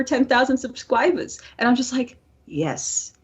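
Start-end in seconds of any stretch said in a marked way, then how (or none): none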